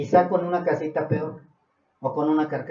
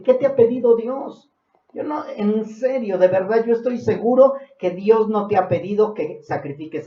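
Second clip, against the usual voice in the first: raised, higher preaching voice; forceful preaching; 8 semitones higher, 6 dB louder than usual. Real, not acted. Preacher challenging the congregation about God's demands, forceful. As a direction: press the congregation hard, loud and high.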